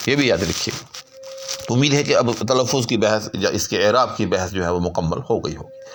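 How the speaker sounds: usual speaking voice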